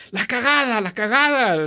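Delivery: high, loud panicked Spanish cry; panicked dad. Retold or imitated. Dad yelling to son about the septic, frantic high cry. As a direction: shout the alarm in a frantic rush.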